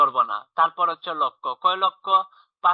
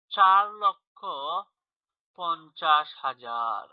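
slow read-out, word by word; slow, drawn-out; slower than usual. Shown as a demonstration. Teacher reading out a number for pupils, slow and drawn-out. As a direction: stretch each word, pausing between the two halves.

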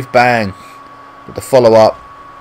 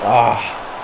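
ordinary talk

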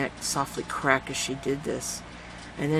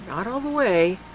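ordinary delivery, nothing marked

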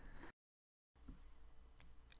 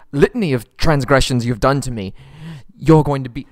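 louder, building angry threat; 2 semitones higher, 8 dB louder than usual. Acted. Captive prince threatening the pirate captain, loud and angry. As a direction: threaten her with rising fury.

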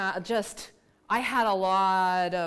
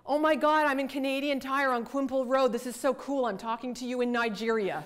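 imitating a thrilled student, pitched up, loud at first; excited grad student; 5 semitones higher, 3 dB louder than usual. Grad student replying to professor from a Canadian store, excited. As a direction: gush like an amazed student.

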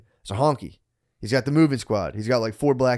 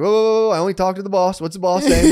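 high, loud mock halt; retold taunting; 6 semitones higher, 5 dB louder than usual. Storyteller replaying his jab at the crew, loud and taunting. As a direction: brush them off with raised, cocky volume.

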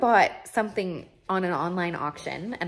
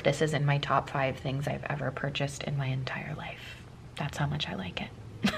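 soft confiding whisper; 3 semitones lower, 9 dB quieter than usual. Real, not acted. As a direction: whisper it like a secret. Confiding about love of egg.

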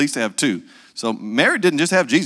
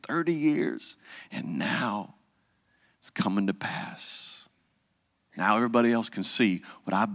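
soft, hushed proud mama; a hint of whispered pride; quiet pride; 4 semitones lower, 7 dB quieter than usual. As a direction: murmur it to yourself. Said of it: "I hear the stories".

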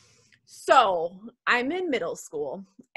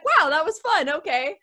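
higher, bright-eyed mock glee after dancing; retold delight; 7 semitones higher than usual. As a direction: replay the fun of the dance in a raised voice. Happy after the school dance.

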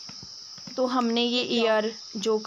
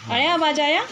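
loud and high so a deaf ear catches it; raised voice; 6 semitones higher, 5 dB louder than usual. Real, not acted. To the hard-of-hearing uncle, loud and high. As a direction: call out clearly to someone hard of hearing. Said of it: "ज़ोर ज़ोर से चिल्ला चिल्ला के बोलना पड़ता है".

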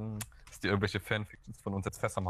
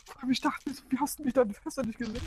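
high-pitched; 14 semitones higher than usual. Real, not acted. Much higher voice.

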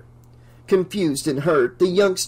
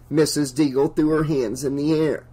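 ordinary delivery

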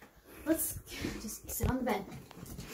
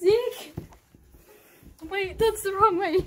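high, loud urgent call; calling out urgently; 6 semitones higher, 7 dB louder than usual. Acted. Calling Zeke back from wrong way, urgent shout. Calling after someone running off.

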